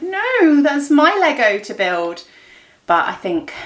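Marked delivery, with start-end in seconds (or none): 0.0-2.2 mock possessive no. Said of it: "and I'm like".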